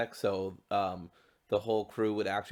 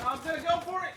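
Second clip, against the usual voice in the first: high-pitched excited announcement; excitedly going for it; excited call; 3 dB louder, faster than usual. Real, not acted. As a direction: psych yourself up in a high voice. Player braces for a leap.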